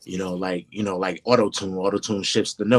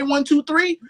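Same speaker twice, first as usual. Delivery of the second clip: much higher, sung-out laid-back count; 4 dB louder, slower than usual. Retold or imitated. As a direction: drawl the count with high swinging cool. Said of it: "When [name] say".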